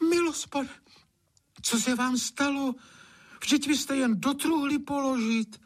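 very high, shocked exclamation; startled cry. A narrator's reading. Anče drops the pan at master's look, high startled cry. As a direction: cry out in fright at the sight of him. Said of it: "Anče leknutím upustila pekáč a vykřikla".

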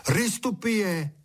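táhlé, vysoko položené zvolání; volání. A narrator's reading. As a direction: call it out high and drawn out. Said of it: "a volal". (protáhlé volání vysokým hlasem)

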